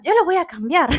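high, loud mock declaration; playful mock of a naive lover; clingy lover vow. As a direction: ham up a hopeful lover swearing to change him.

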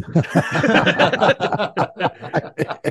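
high, rolling laugh. Real, not acted. Referee joking about the surprised wrestler, laughing. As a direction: burst out laughing at your own joke. Laughs at his own joke.

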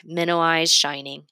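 usual speaking voice

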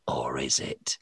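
breathy teasing whisper. Real, not acted. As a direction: whisper it like a spooky secret. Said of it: "teasing whisper to listeners".

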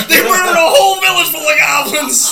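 high, loud mock indignation; outraged shout. Player decrying the goblin village massacre, outraged shout. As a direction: shout the accusation with hammed-up horror.

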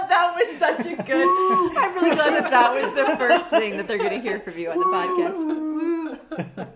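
high, loud, helpless laughing speech. Real, not acted. Host delighted by guest's first sound on the podcast, laughing. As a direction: talk through a big laugh.

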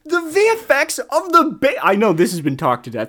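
loud, high disbelief; appalled rant. Real, not acted. Fan ranting about the baby effects, loudly appalled. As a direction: build loudly to an incredulous question.

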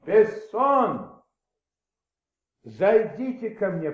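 loud, high call across the office. Acted. Maigret calls his inspector in, shouting his name. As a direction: shout the name through the door.

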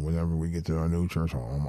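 ordinary talk

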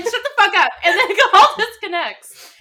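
high, loud, through laughter; laughing. Real, not acted. Telling friends about the show, laughing hard. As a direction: keep telling it while cracking up.

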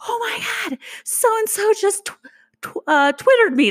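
high, squealing panic in a text to dad; panicked squeal. Retold or imitated. Texting dad in a high panicked squeal. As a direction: shriek out the news in disbelief. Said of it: "I text my dad and I was just like".

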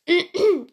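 loud throat clearing; sharp, forceful rasp to clear the voice. Real, not acted. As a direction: clear a hoarse throat hard. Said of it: "jetzt bin ich richtig heiser".

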